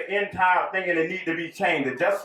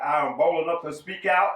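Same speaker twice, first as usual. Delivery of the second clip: ordinary delivery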